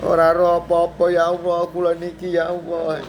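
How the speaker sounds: slow, drawn-out tearful plea to Allah; stretched weeping cry of a helpless baby; mock crying lament. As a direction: wail like a lost newborn calling on God. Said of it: "Di sini mikir nangis".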